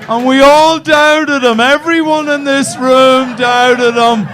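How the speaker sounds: high, loud, hammed-up outrage at the room; mock rally. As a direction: rouse the crowd like a preacher. Mock rally about audience member.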